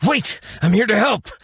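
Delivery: high, desperate call after someone fleeing; urgent calling out. Acted. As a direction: plead with her to stop.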